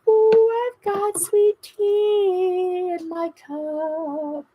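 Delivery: high, slow sing-song about the tea; drawn-out happy gloat; playful delight. Real, not acted. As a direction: tease the audience with a treat. Showing off her sweet tea.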